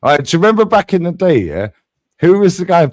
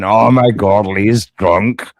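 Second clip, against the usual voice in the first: low-pitched put-on Scottish accent; 6 semitones lower, slower than usual. Shown as a demonstration. Streamer mimicking his accusers, in a Scottish accent. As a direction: do a lower, mock-Scottish voice. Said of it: "when I do it in a Scottish accent".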